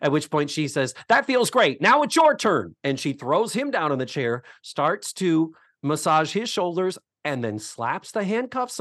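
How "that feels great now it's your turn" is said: higher, lightly hammed-up line delivery; acted-out line. Mama tricking him into the chair, acted out high. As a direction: pitch it up like a quoted sitcom line. Acts out Mama turning the tables.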